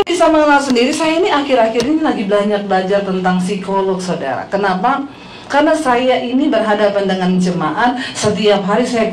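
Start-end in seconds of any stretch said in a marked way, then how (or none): none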